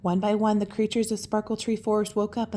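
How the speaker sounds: ordinary delivery, nothing marked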